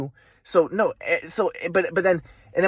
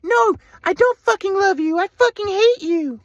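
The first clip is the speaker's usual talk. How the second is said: high, slow sneering mother's voice; mocking mom; 12 semitones higher, 4 dB louder, slower than usual. Mom insulting her teenage son, high sneering voice.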